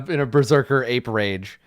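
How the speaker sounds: ordinary talk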